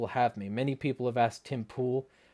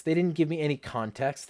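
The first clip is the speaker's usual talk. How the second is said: usual speaking voice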